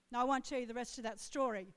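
ordinary talk, nothing marked

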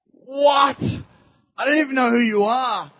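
high, loud disbelief; incredulous outburst. Acted. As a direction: play an indignant fisherman balking at a stranger's order. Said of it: "you can imagine Peter going".

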